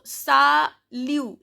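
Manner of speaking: slow "salut" split as sa... lu; "salut" ending on a clear u; silent final T. Shown as a demonstration. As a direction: say "salut" syllable by syllable, dropping the T. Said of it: "T is silent".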